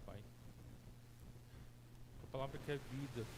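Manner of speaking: soft, hushed opening prayer. Acted. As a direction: pray quietly and reverently.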